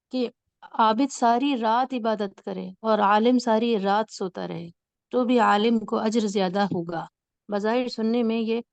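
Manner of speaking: ordinary delivery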